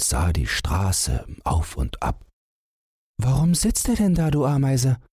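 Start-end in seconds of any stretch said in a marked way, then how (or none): 3.2-5.0 high squeaky little mouse voice; a tiny mouse, worried and curious; high mouse voice asking the ant. A narrator's reading. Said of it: "fragte Wackelohr".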